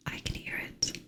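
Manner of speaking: ordinary talk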